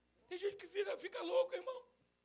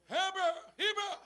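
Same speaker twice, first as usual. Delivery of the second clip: calling out a name; 6 semitones higher than usual. Retold or imitated. Someone calling his name.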